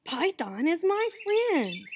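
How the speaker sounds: high, loud, drawn-out protest; protesting iguana. A narrator's reading. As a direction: cry it out insistently, stretching the words. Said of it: "cried Iguana".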